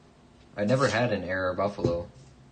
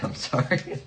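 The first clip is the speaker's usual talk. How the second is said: laughing apology; 7 semitones higher than usual. Real, not acted. Laughing apology after sarcastic joke.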